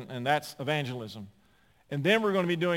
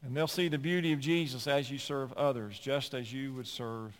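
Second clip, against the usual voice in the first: rising, louder pulpit exhortation, pressing each point; preaching at full force; 3 semitones higher, 4 dB louder than usual. Acted. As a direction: drive it home like a preacher building to a call. Preaches the application.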